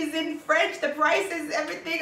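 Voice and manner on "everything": normal talking voice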